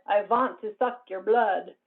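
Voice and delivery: put-on slow, menacing old-movie vampire voice; vampire monster accent. Shown as a demonstration. Demo of the old movie vampire, slow and menacing. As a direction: drag it out like a classic film vampire. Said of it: "with a monster accent".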